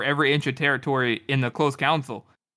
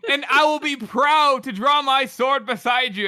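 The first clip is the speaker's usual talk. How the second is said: proud; 8 semitones higher, 3 dB louder, slower than usual. Acted. King's speech.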